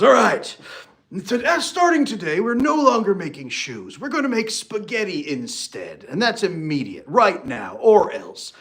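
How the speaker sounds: loud, barking decree from a bad boss; hammed-up tyrant CEO ordering the change; bossy CEO. CEO announcing spaghetti to staff, loud bossy decree. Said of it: "walks into the business meeting like this and goes".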